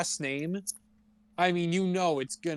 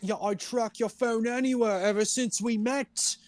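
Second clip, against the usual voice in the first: higher-pitched mock possessive boyfriend; Harden impression; 6 semitones higher than usual. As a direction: justify the stalking like a hammed-up jealous lover. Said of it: "Harden's like".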